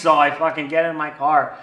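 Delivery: ordinary talk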